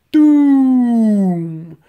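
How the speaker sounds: loud, drawn-out "doom" falling in pitch; booming explosion imitation. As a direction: imitate a blast echoing away. Imitates the dynamite blast.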